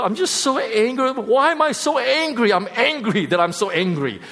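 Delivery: high-pitched acted exasperation; worked-up retelling, easing down at the end; exasperated. Retelling his exasperated self-questioning.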